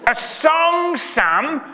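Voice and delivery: high, loud pleading call across the pub; imploring landlady. Acted. Landlady Mabel begs Sam for a song, high and loud. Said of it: "she implores".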